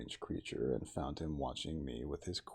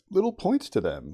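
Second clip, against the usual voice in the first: forced calm; 13 dB louder than usual. A narrator's reading. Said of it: "as calmly as possible, with a catch in my breath".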